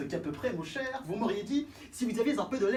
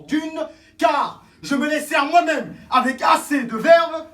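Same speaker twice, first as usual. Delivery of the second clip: louder, higher defiant shout; angry shout; 6 semitones higher, 11 dB louder than usual. Acted. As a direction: hurl it at him. Defiant tirade climax.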